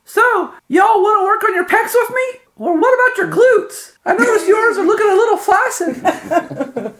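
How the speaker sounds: squeaky falsetto muscleman; very high, loud jock voice; high-pitched voice. Acted. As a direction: greet the crowd in a very high falsetto. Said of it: "says in a high-pitched voice".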